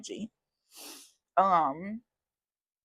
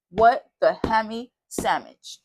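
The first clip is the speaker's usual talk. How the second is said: playful mock curse; 3 semitones higher, 8 dB louder, slower than usual.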